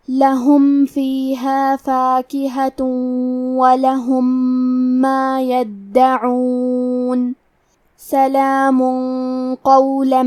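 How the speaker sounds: very slow, drawn-out Quranic chant; Quran recitation. Acted. Teacher recites the verse for students, very slow chant. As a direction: recite slowly, stretching every vowel.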